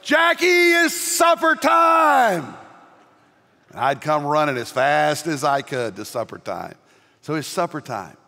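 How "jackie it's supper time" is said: a long, high, drawn-out shout down the block; mother calling out. Retold or imitated. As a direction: call the boy home across town. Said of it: "I would hear her yelling".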